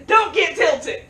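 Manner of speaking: loud, high joking order; mock command. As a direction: shout it as a playful warning. Joke command, then says kidding.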